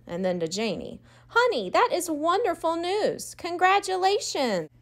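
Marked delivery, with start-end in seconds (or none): 1.3-4.7 proud mom. A narrator's reading. Mother praising daughter's news.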